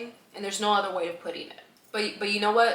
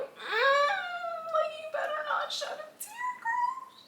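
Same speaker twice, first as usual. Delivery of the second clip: tiny, very high strained squeak; holding back tears; 4 dB quieter, slower than usual. Retold or imitated. Telling herself not to cry past the living room, strained squeal.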